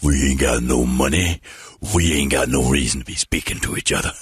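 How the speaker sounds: low, loud put-on pimp voice; menacing pimp impression.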